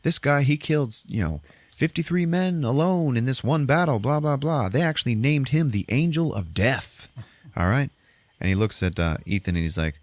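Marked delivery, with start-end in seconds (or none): 0.0-7.9 high, singled-out build-up of a hero; imitated hype man. Gunslinger boasting of comrade to the men, hyping. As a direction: talk him up to the crowd. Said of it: "he starts talking to the men".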